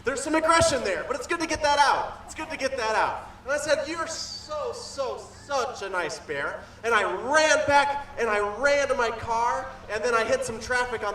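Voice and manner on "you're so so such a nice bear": softly, sweetly to the bear. Acted. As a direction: coo it gently.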